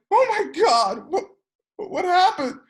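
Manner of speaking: high, loud shocked cry; panicked bar crowd; shocked gasp. Acted. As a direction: gasp in horror at the death. Bar patrons shocked in story.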